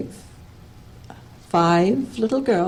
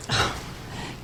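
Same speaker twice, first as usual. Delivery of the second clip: weary sigh; 8 dB quieter than usual. Acted. Weary reply to childish legend.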